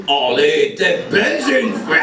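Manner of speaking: put-on drawn-out caricature of a Belgian accent; clumsy French imitation of Belgian accent; fake Belgian accent. Frenchman teases a Belgian, slow hammed-up Belgian accent. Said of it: "ce genre de Français qui se sent".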